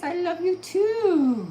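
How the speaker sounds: high, slow, stretched dog voice; voicing the dog. Owner voices her dog telling viewers love, high and slow. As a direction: speak for the pup, drawn out and high.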